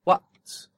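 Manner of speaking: whispered What, glottal stop at the start; unvoiced model of What; whispered demo. Shown as a demonstration. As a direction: breathe out What with a glottal catch. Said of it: "The word for time is".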